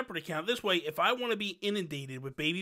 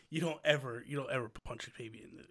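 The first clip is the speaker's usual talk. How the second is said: usual speaking voice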